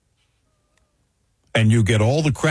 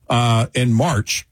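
ordinary talk

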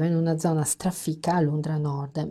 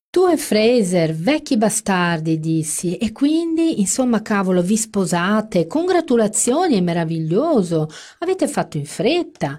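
1 forced gushing; 5 semitones higher, 5 dB louder than usual. Acted. Forced excited congratulations.

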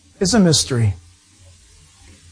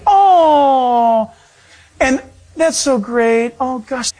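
cooing passenger; 4 semitones higher, much slower than usual. Retold or imitated. Passenger melting over a newborn on the plane, slow high coo. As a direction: melt over the baby, trailing off.

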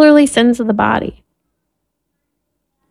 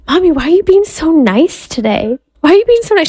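put-on child's voice; 6 semitones higher, faster than usual. Five-year-old daughter quoted.

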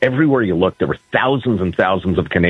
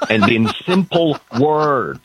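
slow, exaggerated simple words, pitched up at the end; mock loud-and-simple; 5 semitones higher, slower than usual. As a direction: spell it out slowly as if mock-addressing a foreigner. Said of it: "I'll say it really loudly".

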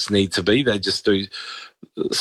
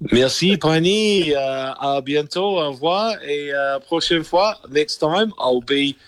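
mock French accent; 6 semitones higher than usual. Replies in French-accented farewell.

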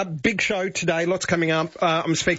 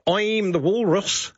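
New Zealand accent; 4 semitones higher, slower than usual. Shown as a demonstration. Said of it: "but in a New Zealand accent".

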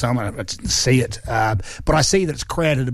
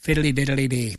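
light mock-Irish lilt; Irish accent. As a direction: toss out a teasing, stagey bit of Irish. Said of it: "I would like to do an accent".

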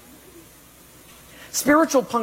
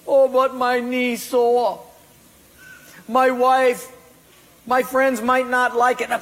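higher, whiny excuse; a reluctant believer whining excuses, higher; mock whiny excuse; 7 semitones higher, 3 dB louder than usual. Excuses voiced mockingly.

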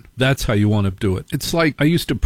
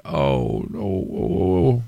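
low, soft dismayed groan; 4 semitones lower, 3 dB quieter, slower than usual. Retold or imitated. Realising he's the parent.

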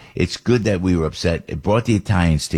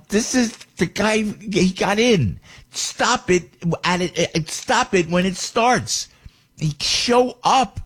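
higher, slow, half-acted disbelief muttered to himself; a lightly voiced exasperated thought, drawn out; incredulous inner voice; 13 semitones higher, slower than usual. Host recalls his own reaction to the news, incredulous and slow. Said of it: "I'm going to myself".

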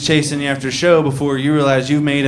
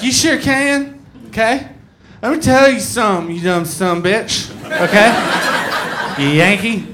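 put-on higher, livid drunk woman voice; mock a drunk angry fan; drunk southern woman; 6 semitones higher than usual. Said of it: "She's like".

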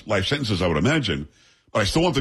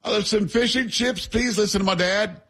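high, hammed-up British accent; mock British accent; 9 semitones higher than usual. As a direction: order like a cartoon Brit. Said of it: "why do I have to have the accent?".

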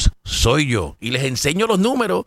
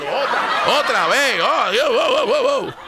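laughing; 8 semitones higher than usual. Real, not acted. Se burla del comentario repetido.

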